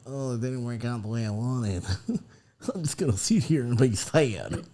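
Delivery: hammed-up pouty southern drawl; sad southern drawl. Host wallowing over a failed plan, mock-sad southern drawl. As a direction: mope it out in a southern twang. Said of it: "I talk like that when I'm sad".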